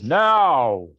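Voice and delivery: one loud, projected command; commanding call. Acted. Khan signals the crowd and fighters to begin, loud command. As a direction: call it out to a whole arena.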